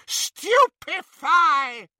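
drawn-out high loud scream; screamed spell. Acted. Bellatrix stunning the snatchers, screamed curse. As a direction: hurl the curse at full voice. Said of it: "she screamed".

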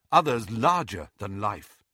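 ordinary delivery, nothing marked

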